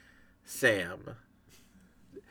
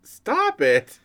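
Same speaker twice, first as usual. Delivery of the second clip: exasperated; 5 semitones higher, slower than usual. Real, not acted. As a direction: scold in mock exasperation. Exasperated at the robot laugh.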